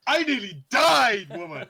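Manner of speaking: high, slow, hammed-up whine; melodramatic complaint. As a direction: overplay the self-pity. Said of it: "Yeah, yeah".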